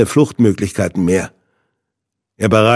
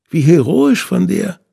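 higher, sneering villain voice; mock his bravery; Hinde, higher and sneering; 8 semitones higher than usual. A narrator's reading. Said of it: "spottete Hinde".

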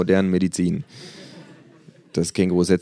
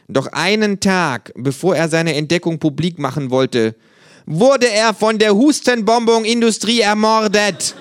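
higher, earnest conspiracy-tale voice; kangaroo storyteller; 7 semitones higher, 4 dB louder than usual. Acted. Kangaroo telling flatmate of a murdered doctor, dramatic tale. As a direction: build dramatically toward the shocking reveal.

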